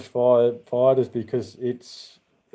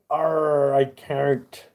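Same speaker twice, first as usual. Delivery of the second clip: pirate voice; 2 semitones higher, much slower than usual. Acted. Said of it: "Need to talk pirate".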